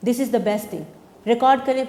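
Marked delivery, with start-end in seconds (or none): 1.3-1.8 emphatic repeat. Real, not acted. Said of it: "Record it".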